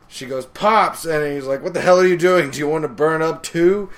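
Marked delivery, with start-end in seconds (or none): 0.5-1.0 put-on high, loud shout to an old man; sharp mock yell; calling out. 1.6-3.9 loud, raised scolding; mock-alarmed telling-off; exasperated yell. Acted yelling in burning house.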